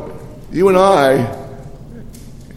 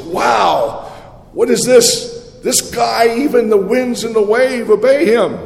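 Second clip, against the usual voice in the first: awestruck; 8 semitones higher, 3 dB louder than usual. Acted. Awed disciples exclaim.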